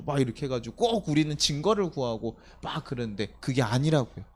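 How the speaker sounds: ordinary delivery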